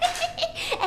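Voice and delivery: put-on high girlish giggle. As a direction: giggle to yourself, flattered. Vain laugh alone. (ضحكة رفيعة)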